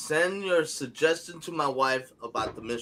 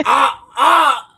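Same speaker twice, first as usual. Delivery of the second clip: two loud, high yelps of pain; pained cries; 9 semitones higher, 11 dB louder than usual. Retold or imitated. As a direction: cry out "ah" twice, re-enacting the jolt. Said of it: "No, I went like this".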